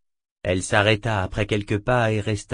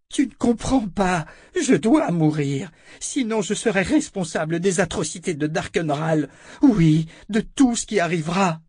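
high, desperate insistence; anguished pleading; 8 semitones higher than usual. A narrator's reading. Callan insists to Richard that she must die, anguished. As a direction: plead frantically to be understood.

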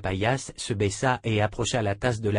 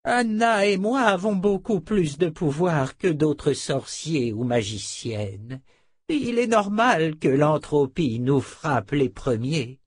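put-on higher, slow, effortful old man; weary old wizard; 4 semitones higher, slower than usual. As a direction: explain slowly in an old sorcerer's strained voice. (vieux sorcier épuisé, voix lente)

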